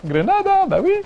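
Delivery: high mock Mexican shout; Spanish accent. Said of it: "Quand je disais que".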